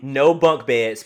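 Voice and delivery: No bunk beds barked loud and slow, bunk hit hardest. Real, not acted. Cohost insisting on good rooms, loud and slow. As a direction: declare No bunk beds firmly, each word heavy. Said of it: "I'm just saying".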